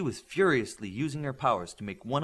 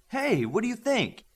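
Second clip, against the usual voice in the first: excited; 11 semitones higher, 4 dB louder than usual. A narrator's reading. Showing off the new room.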